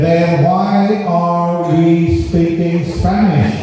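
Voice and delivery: slow punchline. Acted. Punchline said slowly.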